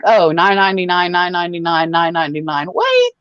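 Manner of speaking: normal talking voice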